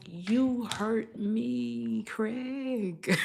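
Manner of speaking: slow, drawn-out hurt voice; mock hurt plea. Talking the partner down, mock hurt and slow. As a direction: drag it out like you're wounded. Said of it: "it's like".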